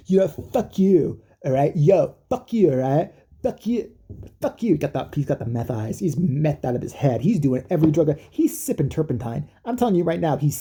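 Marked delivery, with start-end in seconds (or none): 0.1-4.8 hammed-up angry impersonation, drawn out and higher; mock yelling. Celebrity yelling at a girl online, mock angry. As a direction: imitate a druggie cursing someone out. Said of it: "he's just yelling at this girl".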